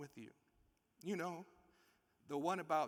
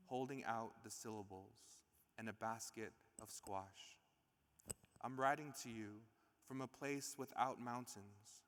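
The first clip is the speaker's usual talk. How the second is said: lower, slow, reverent reading; poem reading; 4 semitones lower, 5 dB quieter than usual. Acted. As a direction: read it as a tribute, unhurried. Reads a letter-poem aloud.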